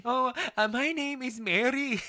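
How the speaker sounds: high, slow Indian woman agent; Indian accent. Acted. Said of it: "so that's my Indian accent".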